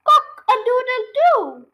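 high, loud, slow crow; squawking rooster. A narrator's reading. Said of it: "squawked the cockerel".

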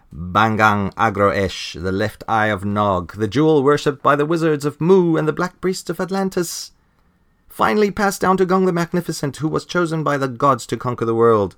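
hammed-up pompous proclamation, rising to a crescendo; grandiose villain boast.

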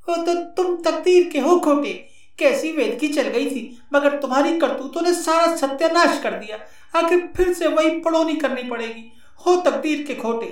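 high woman's voice, scolding; scolding wife voice. Acted. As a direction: berate him as the wife.

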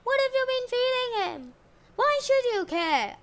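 high-pitched, indignant exchange; acted quarrel. A narrator's reading. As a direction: fling it back in a marital spat. Couple's acted quarrel over the baby.

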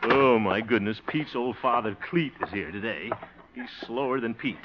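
low, soft grumble; grumbling aside. Acted. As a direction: complain privately, well under the voice. Dismayed aside about slow clerk.

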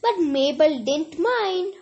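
plain reading voice

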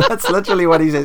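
quick, high words through laughter; laughing. Real, not acted. Podcaster amused by the crying villain, laughing. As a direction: laugh while insisting it's true.

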